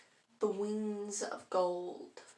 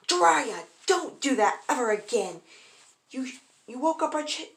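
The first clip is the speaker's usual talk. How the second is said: loud, high-pitched telling-off; raised voice, scolding a friend; acted angry yell; 5 semitones higher, 7 dB louder than usual. A narrator's reading. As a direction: yell it as an indignant mother. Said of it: "Kushina yelled out".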